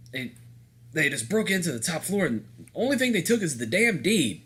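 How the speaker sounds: lightly voiced gruff Southern accent, pitch climbing; a hint of a Southern handyman, rising in disbelief. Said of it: "I'm giving him a gruff Southern accent".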